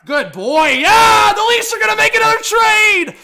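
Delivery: high, very loud celebration; jubilant shout. Real, not acted. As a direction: cheer the dog's prediction at full shout. Celebrating the dog's bark.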